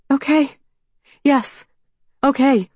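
put-on anxious young woman. A narrator's reading. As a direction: call out, nervous and hopeful. Mallory agreeing eagerly.

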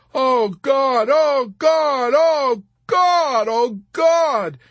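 loud, high, pleading screams of terror; beseeching shriek. A narrator's reading. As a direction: shriek a desperate prayer, over and over. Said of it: "had shrieked beseechingly".

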